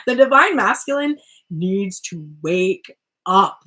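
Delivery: slow, loud, word-by-word "wake up"; exasperated call. Acted. Reader fed up with brother's endless alarm, exasperated call. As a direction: spell out "wake up" emphatically, one word at a time.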